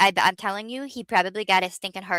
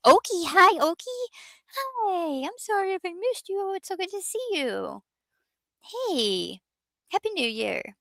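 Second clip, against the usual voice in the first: much higher, sing-song hello; sweet greeting; 11 semitones higher than usual. Real, not acted. Welcoming a viewer into the stream, much higher and sweet. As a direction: greet a friend you've missed.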